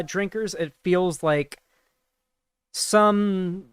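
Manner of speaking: usual speaking voice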